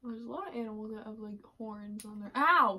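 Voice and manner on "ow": quick yelp; real pained ow; sharp ow of pain. Real, not acted. Clothespin pinching.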